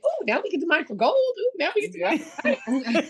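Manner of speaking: high-pitched, quick oohing with delight; mock giddy excitement. Partners sending them to new mines, acted-out giddy excitement.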